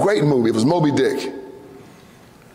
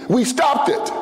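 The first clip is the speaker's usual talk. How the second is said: higher, punched shout; triumphant shout; 7 semitones higher, slower than usual. Real, not acted. Preacher rallying the crowd, triumphant shout. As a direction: declare it like a victory.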